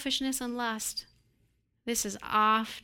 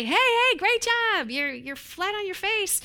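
much higher, louder mock cheer; hollow cheering; 9 semitones higher, 4 dB louder than usual. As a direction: fake cheer at someone flat on their face. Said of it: "God doesn't say".

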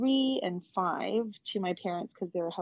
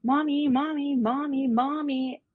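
put-on high, loud, insistent little-kid voice; loud high child calling mommy over and over; nagging toddler; 7 semitones higher, 6 dB louder than usual. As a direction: pester mommy like a three-year-old who won't stop. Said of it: "she's like".

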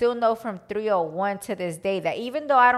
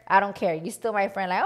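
normal talking voice

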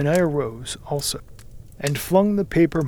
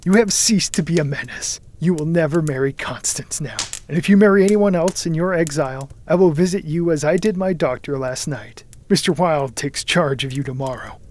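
ordinary delivery, nothing marked

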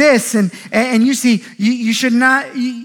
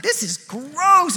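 slow, higher-pitched mock disgust; retold disgust; 5 semitones higher, much slower than usual. Dad retelling a look at the cup, disgusted. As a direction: stretch it out, acting grossed out.

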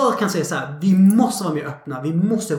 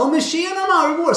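much higher, gushing mock greeting; fake friendly greeting; 10 semitones higher than usual. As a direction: greet her sugary sweet. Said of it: "de blir så här". (mycket ljus, sockersöt hälsning)

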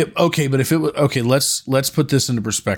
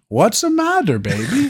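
mock Irish lilt, much higher on matter; hammed-up Irish TikTok guy, pitched way up; imitating Irish accent; 11 semitones higher than usual. Retelling the funny TikTok, in a mock Irish accent. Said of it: "I think they were Irish".

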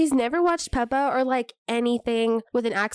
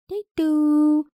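put-on higher, louder little-kid voice; toddler imitation; 5 semitones higher, 5 dB louder than usual. Parent mimicking son given his water, high toddler voice. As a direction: mimic a toddler's cute thanks, drawn out. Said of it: "And he's like".

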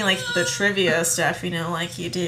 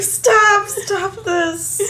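mock plea; 12 semitones higher, 6 dB louder, much slower than usual. Retelling her plea at the film.

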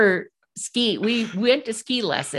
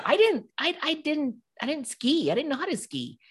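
scared; 8 semitones higher than usual. Retold or imitated. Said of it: "Well, I'm really scared".

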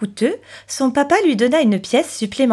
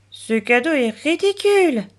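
put-on higher-pitched child's grumble; muttered grumble; 6 semitones higher than usual. A narrator's reading. Chloé heading home with her gift, grumbling. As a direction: grumble it like an unimpressed little girl. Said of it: "marmonna-t-elle".

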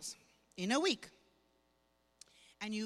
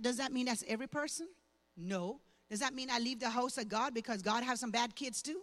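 normal talking voice

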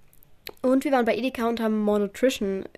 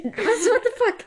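shocked; 10 semitones higher than usual. Real, not acted. Shocked by fake baby announcement.

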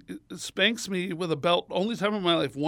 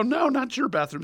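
hasty correction; 7 semitones higher than usual. Retold or imitated. Child hastily correcting the nun.

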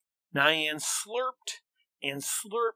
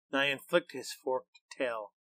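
usual speaking voice

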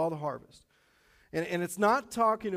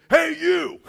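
loud, high shout of alarm; janitor's shout; 12 semitones higher, 13 dB louder than usual. Retold or imitated. Janitor catching kids in the office, loud shout. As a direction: bark it out like a caught-you janitor.

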